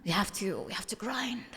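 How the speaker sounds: hushed whisper. Real, not acted. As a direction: drop to a breathy whisper. Whispered aside to chat.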